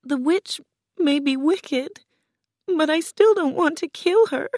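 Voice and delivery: put-on very high, plaintive girl voice; tearful. A narrator's reading. Dorothy begins to cry and refuses, tearful. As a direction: wail it small and high, near tears. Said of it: "Dorothy began to cry".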